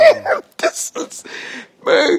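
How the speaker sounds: airy, high laughing disbelief; words dissolve into laughter; breathless laugh. Real, not acted.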